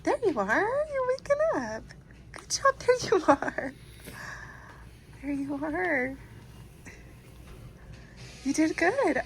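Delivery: excited praise. Real, not acted. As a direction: cheer him on as he wakes. Excited as he wakes.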